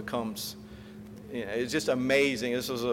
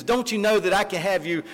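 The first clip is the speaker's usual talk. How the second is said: higher and louder, a frustrated official threatening; angry yelling; 6 semitones higher, 4 dB louder than usual. Retold or imitated. Proconsul threatening Polycarp with burning, angry yell. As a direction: yell the threat, fed up and fussing. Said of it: "starts yelling at him".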